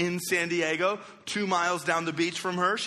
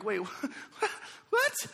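squeaky disbelieving what, pitched way up; startled what; 6 semitones higher, 4 dB quieter, slower than usual. Retold or imitated. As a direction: caught off guard by the early call. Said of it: "I'm like".

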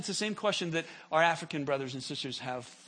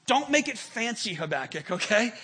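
emphatic paraphrase; 6 semitones higher, 8 dB louder than usual. Acted. Preacher voices God to Habakkuk.

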